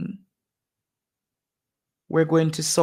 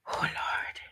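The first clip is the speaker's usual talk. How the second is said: choked whisper; 11 dB quieter than usual. Real, not acted. Helper moved by mother's grief.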